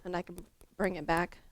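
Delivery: ordinary talk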